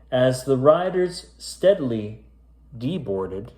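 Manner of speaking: spooky rhyming storybook read; story narration. A narrator's reading. Reading a creepy rhyming tale to kids, storyteller voice. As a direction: read it aloud like a bedtime ghost tale. Reads a spooky rhyme aloud.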